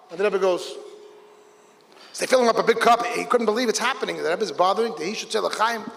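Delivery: ordinary talk, nothing marked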